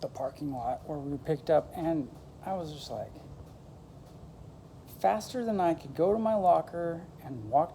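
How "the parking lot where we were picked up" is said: ordinary talk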